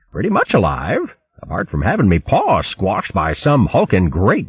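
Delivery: high, hearty upper-class hare voice; high plummy hare chap, amused; jolly old hare. Acted. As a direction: play the bluff, cheerful old soldier hare. Bo cheerful hare voice.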